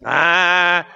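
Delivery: drawn-out mock moaning; hammed-up self-pitying whine; whining complaint. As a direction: whine and grumble through every long groan. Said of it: "I'm murmuring and complaining".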